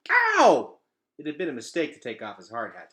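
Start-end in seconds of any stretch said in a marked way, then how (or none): 0.1-0.8 sharp high yelp of pain. Acted. Gregor bumping his head on the ceiling, loud yelp. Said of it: "smacking his head into the ceiling".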